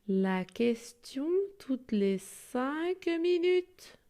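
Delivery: lively storybook reading; expressive children's story reading. Acted. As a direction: read the story aloud to the class.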